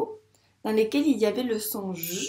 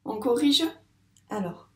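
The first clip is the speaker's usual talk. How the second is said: ordinary delivery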